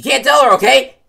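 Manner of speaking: urgent. Acted. Urgent plea.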